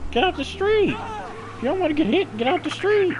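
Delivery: squeaky high shout; high-pitched yell. Acted. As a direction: shriek it way up high. Yelling at pedestrians, voice climbs.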